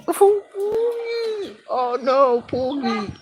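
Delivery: high, hammed-up crying wail of pogi; mock wailing. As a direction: wail it like a fake heartbroken diva. Mock wail over being handsome.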